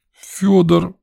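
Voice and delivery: put-on high, tearful child's gasp; convulsive exhale. A narrator's reading. Boy names his brother, trying not to cry. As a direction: breathe out the name, fighting a wail. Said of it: "судорожно выдохнул Ваня".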